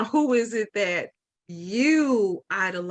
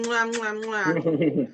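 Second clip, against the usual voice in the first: blown kisses; 2 semitones lower, much slower than usual. Real, not acted. Blowing kisses to Tank.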